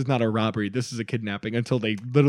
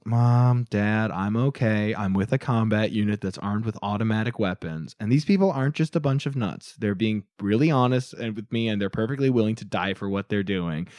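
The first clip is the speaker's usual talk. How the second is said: bored monotone; 6 semitones lower, 5 dB quieter than usual. Retold or imitated. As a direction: read it like a hostage bored stiff. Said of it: "she just kinda sounds bored".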